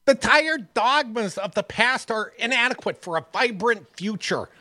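high, whiny campaign-speech voice; whiny politician voice. Retold or imitated. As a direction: whine it like a pompous candidate. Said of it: "a whiny bitch voice".

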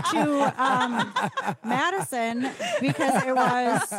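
laughing. Real, not acted. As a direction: laugh through the words. Laughing mid-sentence.

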